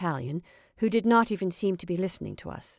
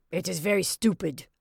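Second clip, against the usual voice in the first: growled. A narrator's reading. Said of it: "he growled".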